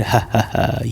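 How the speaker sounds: ordinary talk, nothing marked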